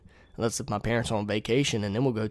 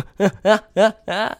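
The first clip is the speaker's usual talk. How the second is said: loud, high-pitched laugh; 10 semitones higher, 9 dB louder than usual. Real, not acted. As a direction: burst out laughing loudly. Laughs after quoting the insult.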